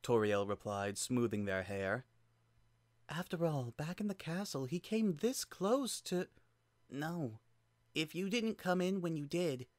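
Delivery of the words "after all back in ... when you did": put-on soft, higher child voice; a child trailing off, unsure; gentle child voice, hesitant. Frisk's halting dialogue.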